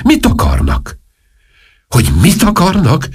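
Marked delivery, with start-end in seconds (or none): 1.9-3.1 high-pitched, incredulous comeback; exasperated echo. Acted. King snaps back the duchess's question, high and exasperated. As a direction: throw the question back, voice jumping up.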